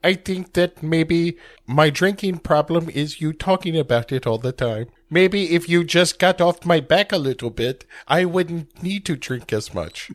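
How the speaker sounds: a hint of a sulky robot. Host voicing Val brushing off Aqua, a light whiny robot. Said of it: "Val answers Aqua".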